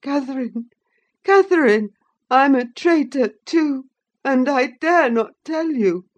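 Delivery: high, loud tearful begging; sobbing terror. A narrator's reading. Linton sobbing and begging Catherine to stay. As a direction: plead for your life through tears. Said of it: "he sobbed".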